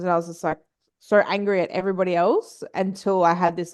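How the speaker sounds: normal talking voice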